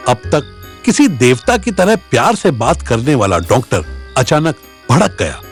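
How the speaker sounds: ordinary delivery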